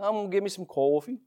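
light New York "I'm gonna get me some coffee"; New Yorker ordering coffee, lightly mocked; mock New York accent. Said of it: "you hear them talk".

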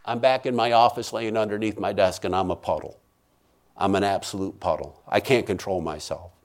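low and soft, emptied out; subdued, low-voiced collapse; drained, shaken recounting. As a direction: drop right down after the screaming. Aftermath of the terrifying vision.

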